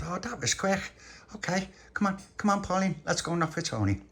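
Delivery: put-on high-pitched, hurrying child's voice; eager little boy. Little pappy hurrying Pauline to Tony's, eager little boy. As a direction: urge a friend along in a high child's voice.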